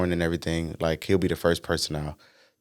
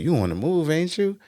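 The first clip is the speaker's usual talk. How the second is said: proud dad voice; 10 semitones higher than usual. Retold or imitated. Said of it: "he was like".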